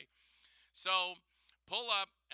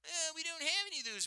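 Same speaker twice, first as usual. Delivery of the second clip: high, put-on counter worker voice; high mock worker to the annoyed customer; mock cashier voice; 12 semitones higher, 5 dB louder than usual. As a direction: pitch way up like the bored cashier. Retold cashier reply.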